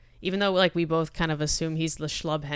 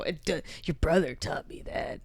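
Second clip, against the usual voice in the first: edgelord voice; 7 dB quieter than usual. Retold or imitated. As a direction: brood it out like a tough guy. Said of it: "That's just my default edgelord voices".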